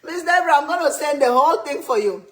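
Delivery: high, loud call to a viewer; excited shout-out. Real, not acted. Host calling out to viewer Miss Deborah, excited. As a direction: call out her name loudly with delight.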